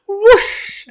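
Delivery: loud, high whoosh; explosive whoosh of emotion; imitated gush of tears. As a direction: make it burst out suddenly. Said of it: "Out it comes again".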